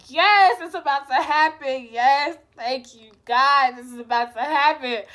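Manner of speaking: loud, eager rising yes; cheering that it's finally happening; excited. Retold or imitated. Hyped teen cheering her luck as pants come off. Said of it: "and I'm just like".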